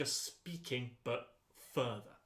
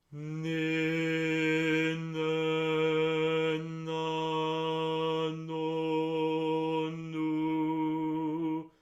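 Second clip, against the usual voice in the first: higher, louder single-pitch projection; 3 semitones higher, 8 dB louder, much slower than usual. Shown as a demonstration. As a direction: lift the note and carry it. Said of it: "Are you still speaking it?".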